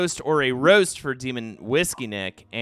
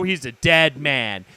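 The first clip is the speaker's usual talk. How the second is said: normal talking voice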